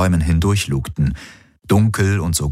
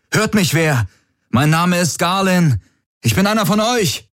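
high, sustained calls into the distance; calling out; 12 semitones higher than usual. A narrator's reading. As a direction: call out for anyone who might hear. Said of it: "die Hände als Trichter an den Mund gelegt". (mit hoher Stimme in die Ferne rufen)

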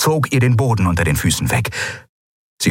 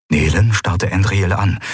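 ordinary delivery

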